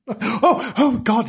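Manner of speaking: high, flustered dismay; mock horror. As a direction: gasp it out in comic alarm. Husband horrified after slapping wife.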